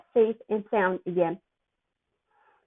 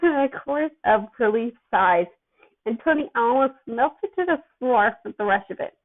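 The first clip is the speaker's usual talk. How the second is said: usual speaking voice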